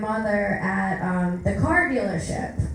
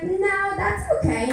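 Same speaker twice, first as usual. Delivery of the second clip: high polite refusal; 7 semitones higher than usual. Retold or imitated. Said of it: "I was like".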